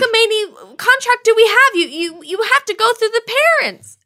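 high, loud outraged protest. Acted. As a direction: explode at the contract. Outraged parent.

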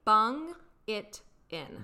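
each word of "Bung it in" spelled out slowly; slow, deliberate bung it in. Shown as a demonstration. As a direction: lean on "bung" and draw out "Bung it in" for a learner. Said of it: "specifically the, um, saying".

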